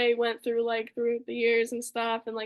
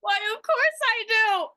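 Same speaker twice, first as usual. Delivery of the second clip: Ken's reply; 8 semitones higher, 5 dB louder than usual. Retold or imitated. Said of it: "And he goes".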